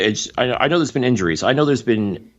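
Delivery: ordinary talk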